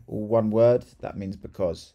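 usual speaking voice

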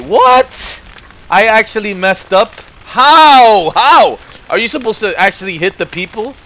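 sudden high, loud outraged how; real shocked protest; stunned yell at the game; indignant disbelief. Real, not acted. Learns he messed up.

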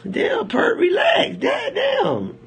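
hammed-up high whiny woman; mock woman's voice. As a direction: squeak it like the annoyed girl. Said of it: "she like".

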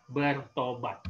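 usual speaking voice

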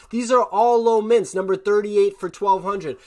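worked up in disbelief at the numbers; fired up. Real, not acted. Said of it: "I know I'm getting fired up right now".

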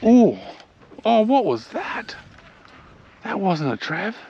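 stunned by a huge hit; shocked. Real, not acted. Angler reacts to an unseen big fish striking, shocked.

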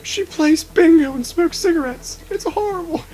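high, wailing mock dread; mock horror voice. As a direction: ham up the demon panic. Mock monster rant.

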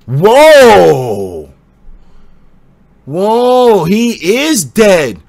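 loud, stunned "Whoa!" that drops away; reeling from sudden bad news; shocked. Real, not acted. Streamer reading that the creator died, loud and stunned.

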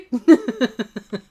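high, loud laugh. Real, not acted. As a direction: crack up at your own mistake. Laughs at own mistake.

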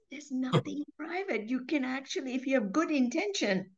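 normal talking voice

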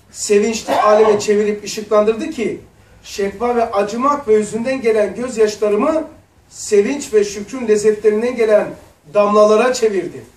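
loud, measured recitation of scripture; solemn reading. Acted. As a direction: read the text aloud with reverent weight. Reads Risale-i Nur aloud. (vaaz tonunda ağır okuma)

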